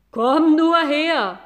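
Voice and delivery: loud, slow lowing dare; an angry cow daring the boy; threatening cow. Acted. Cow dares the boy to come closer, loud slow lowing voice. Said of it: "sagte Mairos".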